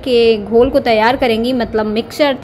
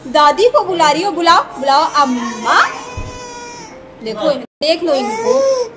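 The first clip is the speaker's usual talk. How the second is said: put-on sing-song cooing to a baby, higher and lilting; baby talk; 4 semitones higher than usual. Aunt playing with her baby niece, sing-song baby talk. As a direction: coo and tease the little one like a doting aunt.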